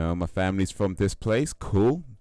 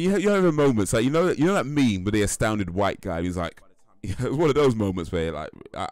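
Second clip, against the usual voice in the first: ordinary talk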